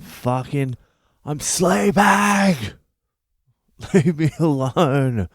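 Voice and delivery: high, drawn-out bellow, hammed-up; exasperated shout. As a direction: holler it at the door, stretching the words. Yelling at knocker again.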